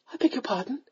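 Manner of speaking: small, high, dumbfounded voice; stupefied. A narrator's reading. Young lady hears they will marry, stupefied. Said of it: "she said stupidly".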